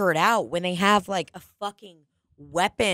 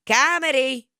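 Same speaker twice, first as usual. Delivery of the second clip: one word, higher, drawn out and deadpan; Mark Normand impression; 5 semitones higher, slower than usual. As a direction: announce it like a one-word bit. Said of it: "And he goes".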